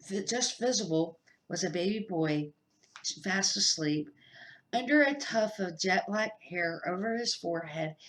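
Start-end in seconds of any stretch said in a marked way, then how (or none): none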